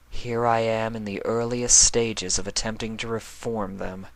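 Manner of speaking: dreary inner thought, lightly put on; drearily. A narrator's reading. Luna thinking to herself, a lightly voiced dreary sigh. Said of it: "she thought drearily".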